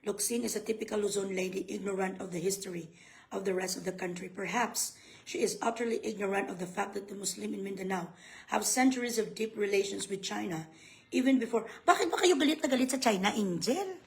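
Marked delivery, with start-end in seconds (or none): 0.1-11.7 low, soft article reading; subdued, low read-aloud delivery. Real, not acted. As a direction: read it out quietly in a lowered pitch. Reads the article text aloud.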